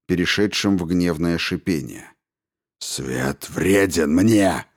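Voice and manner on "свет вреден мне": slow, low seething rage; muffled fury. A narrator's reading. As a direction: growl it out with smothered fury. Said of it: "с глухой яростью в голосе".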